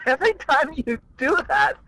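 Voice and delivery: high laughter through the words; laughing. Real, not acted. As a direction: giggle helplessly while talking. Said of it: "You're an easy laugh".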